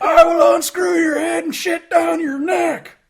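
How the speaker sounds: hammed-up high yelled drill instructor; drill sergeant. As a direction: bellow it like R. Lee Ermey in boot camp. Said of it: "he sounded like R. Lee Ermey".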